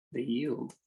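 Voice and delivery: the yield, dropped soft with a light rise; softly repeating to savour the word; soft echo. Shown as a demonstration. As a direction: let "the yield" fall away quietly with a lift at the end. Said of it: "repeated phrase, softer echo".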